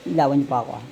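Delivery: ordinary talk, nothing marked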